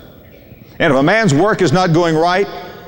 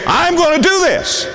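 much higher-pitched, imitated bold announcement; mock-determined, pitched way up; bold declaration; 12 semitones higher than usual. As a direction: announce it like a man set on his plan. Acting a determined man's announcement.

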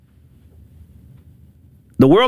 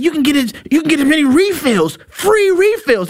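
amazed visitor; 6 semitones higher than usual. Retold or imitated. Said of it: "And he said".

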